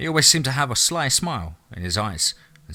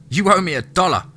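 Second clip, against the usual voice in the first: put-on higher, louder insistent boy; demanding kid brother; 8 semitones higher, 6 dB louder than usual. A narrator's reading. Boy claiming his bet from Lance, demanding. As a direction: pipe up insistently for what's owed.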